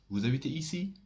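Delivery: "Vous habitez ici?" rising to the end of "ici"; rising question intonation. Shown as a demonstration. French teacher repeats the model question, rising question intonation. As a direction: lift your voice on "ici?". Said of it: "you will make your voice up".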